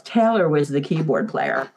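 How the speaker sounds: ordinary talk, nothing marked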